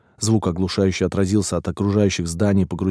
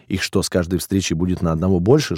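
ordinary delivery, nothing marked

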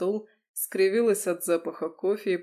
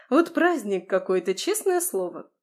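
excited; 3 semitones higher, 5 dB louder than usual. Acted. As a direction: punch "Вот", lift "честное слово" way up. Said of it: "размахивала руками".

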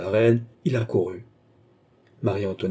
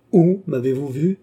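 demanding, higher and louder question; terrible voice; 6 semitones higher, 7 dB louder than usual. A narrator's reading. Queen confronting Philippe about where he saw her, terrible voice. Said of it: "d'une voix terrible".

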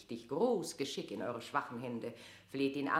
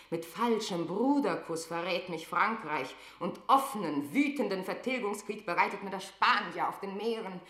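rising fury; 6 semitones higher, 5 dB louder than usual. Acted. Elisabeth raging alone, monologue.

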